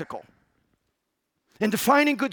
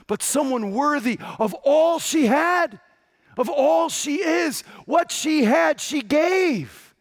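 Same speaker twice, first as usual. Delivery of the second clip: loud, higher, stressed all; preaching climax; 7 semitones higher, 4 dB louder, slower than usual. Real, not acted. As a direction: build to a ringing peak.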